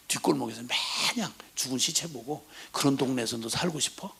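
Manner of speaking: hushed threatening whisper; a father's grim whisper to his son; menacing whisper. Acted.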